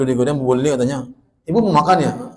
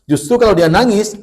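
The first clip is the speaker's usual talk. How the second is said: higher, louder insistent point; emphatic preaching; 8 semitones higher, 8 dB louder than usual. Real, not acted. Preacher urges mothers about crying child, emphatic. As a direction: press the point home.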